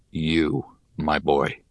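put-on low, calm old mentor; wise old teacher voice. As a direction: speak like a grave old sage. Cairpray speaks, low voice.

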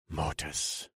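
low, soft incantation; whispered spell. A narrator's reading. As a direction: breathe the spell word. Casting spell quietly.

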